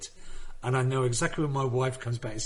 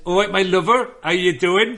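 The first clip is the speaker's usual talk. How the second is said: loud, higher West Country greeting; West Country accent; 6 semitones higher, 10 dB louder than usual. Shown as a demonstration. Comic showing off to friends, West Country accent. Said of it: "West Country accent".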